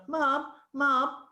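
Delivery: put-on high child's call for attention; boy calling Mum. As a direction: imitate a boy pestering his mother. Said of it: "Pete had just gone".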